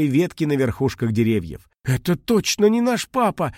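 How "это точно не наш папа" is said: scared cry, pitched much higher; little dragon alarmed; high, fearful exclamation; frightened. Acted. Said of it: "со страхом сказал Пых".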